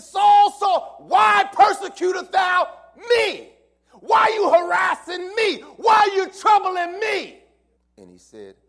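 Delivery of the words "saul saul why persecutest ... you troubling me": much higher, louder booming shout; voice of the Lord. Acted. The Lord calling Saul on the road, booming shout.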